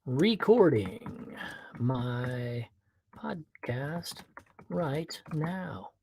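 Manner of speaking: slow, halting, word by word; talking while typing. Real, not acted. Podcaster typing a chat message, slow and halting. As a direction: quietly sound out each word as you type.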